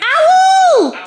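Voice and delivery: high, very loud yell; excited shout. Real, not acted. Quiz viewer calls out the answer, high loud shout.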